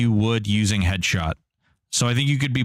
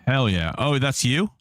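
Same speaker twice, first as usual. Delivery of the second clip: ordinary talk, nothing marked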